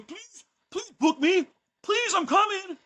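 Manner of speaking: high-pitched, drawn-out pleading; mock begging promoter. Promoters begging the wrestler for bookings, high mock plea.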